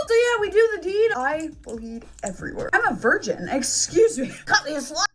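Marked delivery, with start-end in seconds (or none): none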